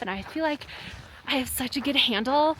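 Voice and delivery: usual speaking voice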